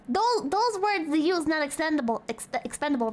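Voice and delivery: ordinary talk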